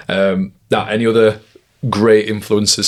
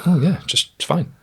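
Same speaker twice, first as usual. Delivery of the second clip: reassuring; 9 semitones higher than usual. Retold or imitated. Reassuring the confused worker.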